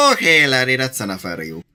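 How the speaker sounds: put-on Irish accent. Player trying out an Irish telling-off, mock Irish accent. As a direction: put on a rough Irish brogue. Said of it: "if I could do an Irish accent".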